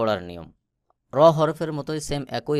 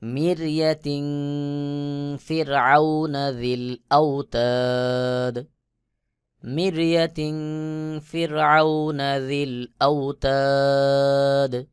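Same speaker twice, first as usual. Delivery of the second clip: melodic recitation chant; Quran recitation; 3 semitones higher, 4 dB louder, slower than usual. Shown as a demonstration. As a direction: intone the verse slowly and tunefully.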